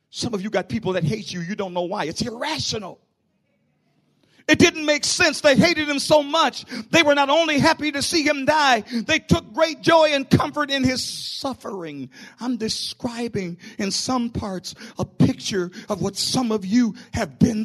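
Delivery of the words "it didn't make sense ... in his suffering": sudden loud sermon burst; loud preaching surge. Acted. Pastor describing the crucifixion, loud preaching. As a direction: hit them with it.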